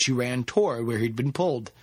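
usual speaking voice

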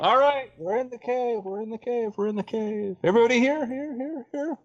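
repeating words like an echo; fading echoing repeats; cave echo. Acted. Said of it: "with that echo and echo?".

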